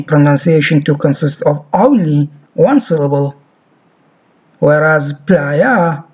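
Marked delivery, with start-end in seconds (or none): 5.1-6.0 playa said slowly as plaa; slow model word. Shown as a demonstration. Teacher shows how we say playa, slowly. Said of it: "as we say".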